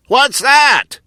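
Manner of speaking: loud, high shout from a crowd of robots; shouted question. A narrator's reading. Huggos shouting at Muggo, loud high question. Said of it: "shouted all the Huggos".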